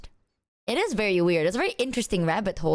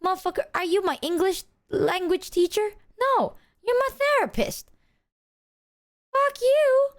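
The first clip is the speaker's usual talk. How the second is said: mock outburst; 9 semitones higher than usual. Telling off the therapist over word choice, hammed-up yell rising to a shout. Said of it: "And I'm like".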